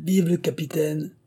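high-pitched cheer, no real shout; cheering cry. A narrator's reading. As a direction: hail him in a lifted voice. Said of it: "criait-il".

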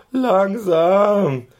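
high, loud shriek. A narrator's reading. Driver shrieking at the runaway pony, high and loud. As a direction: shriek it from the coach box. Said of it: "kreischt es aus zwei Mündern laut".

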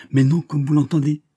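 loud, high firm answer; firm reply. Acted. Verduret answers the banker, loud firm reply. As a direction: cut in with certainty.

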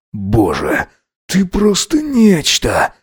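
drawn-out, overcome exclamation; hoarse awe. A narrator's reading. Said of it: "прохрипел он".

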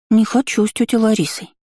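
put-on high pouting little girl; sulky child. A narrator's reading. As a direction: pout and refuse like a child. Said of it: "недовольно надула губки".

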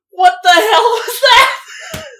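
high, loud, slow incredulous cry; stunned disbelief. Retold or imitated. Narrator reliving her reaction to the man, shocked shout. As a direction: gasp it out in shocked amazement. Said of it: "And I'm like".

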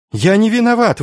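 high-pitched protest of innocence; desperate cry. A narrator's reading. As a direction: cry out against the accusation. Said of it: "выкрикнул тот".

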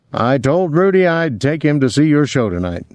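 a touch of hearty big talk; lightly voiced Willie. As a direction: put the question with a playful lift.

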